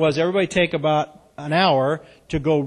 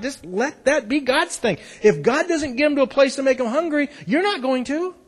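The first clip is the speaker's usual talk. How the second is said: raised, fervent preaching; 9 semitones higher, 6 dB louder than usual. Acted. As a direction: preach it with passion, loud. Preaching exhortation.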